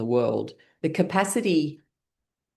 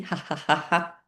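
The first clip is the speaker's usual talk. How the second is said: light laughter; 3 dB quieter than usual. Shown as a demonstration. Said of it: "Little bit of shaking".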